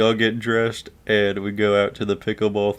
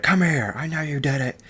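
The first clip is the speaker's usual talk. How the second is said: high-pitched mock scolding; accusing teacher; 8 semitones higher than usual. Teacher summons the blamed kid, sharply accusing. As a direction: imitate a teacher calling out a culprit.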